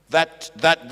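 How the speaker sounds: ordinary delivery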